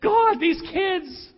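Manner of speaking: slow, exasperated cry to God; a parent groaning to God at bedtime; exasperated prayer. Retold or imitated. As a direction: drag it out in mock despair. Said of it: "praying by my bed".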